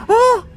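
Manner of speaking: high, loud mock gasp of shock; imitated crowd gasp. Whole store reacting to his swearing, high mock-shocked "oh". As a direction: imitate a whole shop going "oh". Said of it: "everyone in the store was like".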